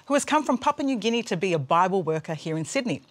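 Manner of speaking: normal talking voice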